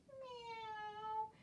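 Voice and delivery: high, soft, rising kitten meow; mewing like a cat. Pretend. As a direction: squeak it out like a tiny cat. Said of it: "Let's do one more cat".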